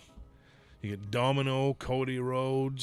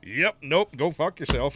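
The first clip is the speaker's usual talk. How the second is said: mock curt brush-off; 3 semitones higher, 7 dB louder than usual. Wrestler hangs up on offer.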